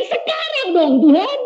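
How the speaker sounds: high, whiny plea; man pleading with God again; childish pleading. Retold or imitated. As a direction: beg like an impatient child.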